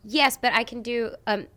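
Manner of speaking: usual speaking voice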